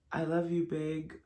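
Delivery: tender small voice trailing off; soft child voice. Retold or imitated. Quoting the boy's last words to his mother, tender and soft. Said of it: "was just like".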